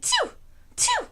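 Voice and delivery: imitated tiny sneeze; kitten sneeze. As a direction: mimic a kitten's little sneeze.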